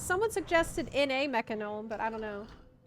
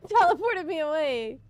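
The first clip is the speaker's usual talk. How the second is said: surprised; 5 semitones higher, 8 dB louder than usual. Real, not acted. Accidental teleport mid dungeon.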